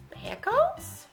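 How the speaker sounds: high-pitched baffled question; puzzled. A narrator's reading. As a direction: swoop up sharply, as if baffled. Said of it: "Pete and the gang were puzzled".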